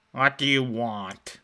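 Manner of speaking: put-on slow, growled old man's question; a lightly voiced gruff old man, drawn out; hint of a rough old man snapping at visitors. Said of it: "in a rough, gruff voice".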